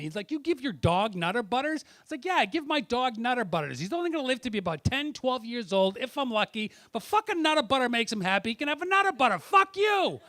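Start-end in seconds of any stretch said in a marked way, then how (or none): 0.3-1.8 louder, higher retold yell at the checkout. Cashier yelling at the comic over dog treats, a loud outraged question. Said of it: "Fucker yells at me". 2.2-10.2 louder, higher rant building to a yelled curse; retold angry rant. Comic snapping back at the cashier, a building angry rant.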